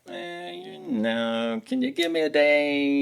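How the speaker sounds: high, drawn-out reluctant hedging; mock-reluctant, slow and high-pitched; hesitant stalling. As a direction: imitate her stalling, stretching the words out slowly. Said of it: "she's kinda like".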